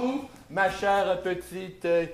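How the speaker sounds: low, slow, soft butler. Acted. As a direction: serve it like a polite valet. Improv, plays a butler serving. (voix de majordome grave et lente)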